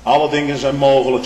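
the Lord answering the father; proclaiming Lord. Acted. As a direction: announce it with authority. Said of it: "zegt de Heere".

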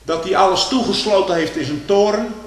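preacher lamenting a silent God, loud; impassioned cry. Acted. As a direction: call it out with anguished force. Preacher cries out about God's silence.

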